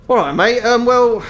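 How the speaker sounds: high, hesitant elf shopkeeper; utterly confused elf. Acted. Elf catches the customer staring at him, high and confused. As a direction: pitch it up, lost for words. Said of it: "utter confusion".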